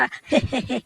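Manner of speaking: short gloating giggle; cheeky he-he-he; mischievous chuckle. Acted. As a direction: chuckle gleefully at your own threat. Gloating while threatening a poke.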